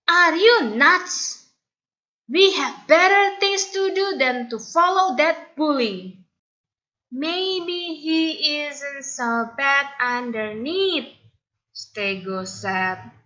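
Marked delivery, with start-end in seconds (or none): 0.1-6.2 indignant outcry. Acted. Bolan indignant. 7.1-11.1 higher, softer, slow hesitant voice; timid friend. Acted. Timid Stego hopeful.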